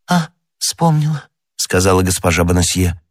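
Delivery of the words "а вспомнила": high, faint, halting; weak recollection. Acted. Dying woman remembers the poisoner, weakly. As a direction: recall it with failing strength.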